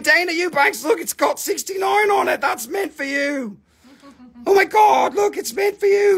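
high, shouting with delight; excited yelling. Real, not acted. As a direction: pitch way up, thrilled, calling out to her. Excited about sixty-nine stone.

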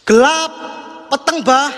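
sudden high loud "Gelap" rising; startled cry. Real, not acted. Streamer startled when the lights go out, sudden high cry. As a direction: yelp it out as the room goes dark.

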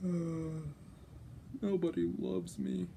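soft, mopey mock moan; Shinji's angst. Imitating Shinji feeling unloved, mopey angst. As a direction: groan it quietly in self-pity. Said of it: "you probably heard about Shinji's angst".

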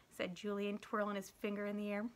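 ordinary delivery

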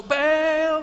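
high, loud, drawn-out call to Baal; crowd yelling to a god. Acted. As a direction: bellow the name upward like a desperate crowd. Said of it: "call nice and loud".

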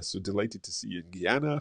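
ordinary delivery

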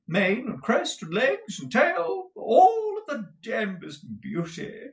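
slow, high theatrical gushing; affected, flamboyant husband. Acted. Husband picturing rejected countesses' envy, high affected gushing. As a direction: swoop and drawl with foppish relish. Mantalini's effusive speech in character.

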